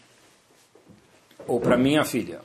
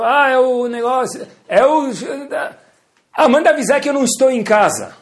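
raised, loud call across the house; speaking out loud; 10 semitones higher, 5 dB louder than usual. Acted. Parent calling to child who answered the phone, speaking out loud. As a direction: call out high and loud, hesitant at first. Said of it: "Ele fala alto".

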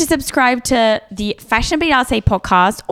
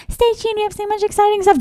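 high, fast mock promo voice; mocking fake excitement; annoying hype; 10 semitones higher, faster than usual. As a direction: mimic gushing hosts, pitched way up. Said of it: "so fucking annoying when people are like".

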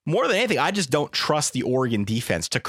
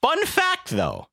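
playful; 14 semitones higher, much slower than usual. Acted. Playful aside.

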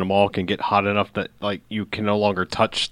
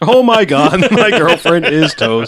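laughing through a slow repeat; 5 semitones higher, 5 dB louder, slower than usual. Retold or imitated. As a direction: laugh while repeating it slowly.

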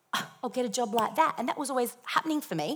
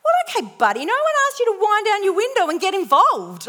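indignant; 11 semitones higher, 7 dB louder than usual. Retold or imitated. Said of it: "in my head".

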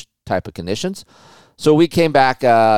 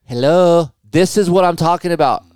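exasperated retelling; 8 semitones higher than usual. Retold or imitated. Retells pleading with wife.

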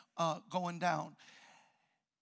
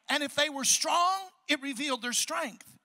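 preaching; 5 semitones higher than usual. Acted. Pastor preaches to the church about revealed hearts, rhythmic preaching. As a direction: hit each pair like a refrain.

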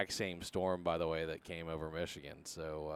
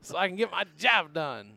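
exasperated parent; 10 semitones higher, 9 dB louder than usual. Pretend. Parent venting about kids home.

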